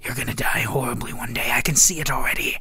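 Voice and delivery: menacing whispered prophecy; ominous whisper. Acted. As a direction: whisper his doom.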